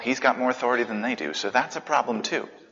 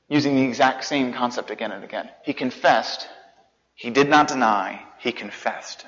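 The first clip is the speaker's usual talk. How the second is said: normal talking voice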